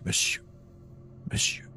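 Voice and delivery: quiet, cautious whisper; hushed call. Acted. Game master replays the investigator's words to the captive, hushed call. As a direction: call under your breath.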